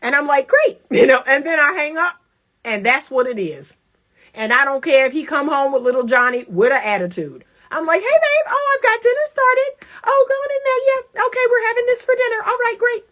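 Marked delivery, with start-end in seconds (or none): 0.5-0.8 very high, bright mock cheer; breezy, high-pitched thanks; cheery great. Acting her cheery reply to him. 8.0-13.0 high, sing-song mock cheer; high-pitched, chirpy acted homecoming chatter; breezy wife voice. Acting cheerful greeting, ignoring husband's attitude.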